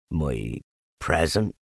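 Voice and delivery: low, slow donkey question; Eeyore, downcast. A narrator's reading. Eeyore questions his gift.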